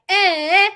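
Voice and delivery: put-on high, loud toddler "eh"; short wordless mock holler; mimicked toddler shout. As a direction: imitate a baby yelling up the stairs. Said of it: "make that sound whenever I'd call".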